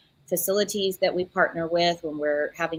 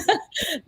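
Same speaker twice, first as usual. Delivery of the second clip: light laugh; 10 semitones higher than usual. Real, not acted. Patient in Philippines, laughs.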